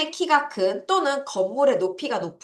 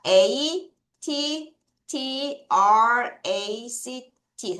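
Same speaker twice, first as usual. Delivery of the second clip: letter by letter, very slow; slow spelling for the class; much slower than usual. Shown as a demonstration. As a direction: spell it out deliberately. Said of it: "왜 끊었냐 말씀을 드릴게요".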